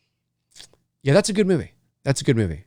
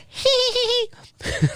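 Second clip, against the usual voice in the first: squeaky high hee hee giggle; childish giggle; 4 dB quieter than usual. Retold or imitated. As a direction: giggle like a five-year-old. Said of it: "my five-year-old self was".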